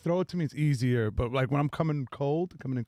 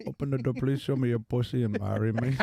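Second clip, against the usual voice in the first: put-on laughing Indian accent plea. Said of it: "Indian gas station wedding proposals".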